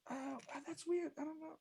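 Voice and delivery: high, soft character voice; softly baffled, pitched well up; puzzled secretary. Acted.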